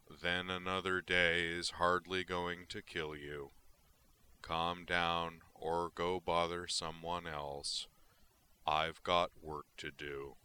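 slow, overly patient monotone; exaggerated patience. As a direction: drag it out with mock patience. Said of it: "Exaggerated patience".

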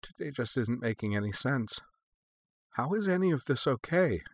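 quietly at a loss; slightly hollow. A narrator's reading. Young woman overwhelmed by a senseless day, hollowly. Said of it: "her voice sounding slightly hollow".

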